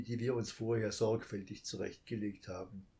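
ordinary delivery, nothing marked

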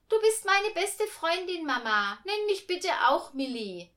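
put-on high, loud child's request; pleading little girl. A narrator's reading. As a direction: voice a girl asking her mum eagerly. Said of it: "hatte sie sich von der Mama gewünscht".

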